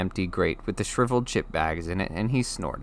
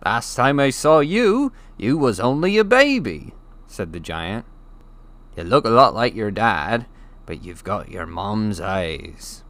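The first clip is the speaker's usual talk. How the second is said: fond giant; 5 dB louder than usual. Acted. The giant greets Harry fondly, hearty then gentle. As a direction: greet the boy you last saw as a baby.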